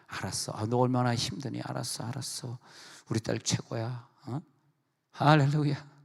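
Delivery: mother calming her upset daughter, softly; soothing mother. Retold or imitated. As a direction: hush and comfort her daughter gently.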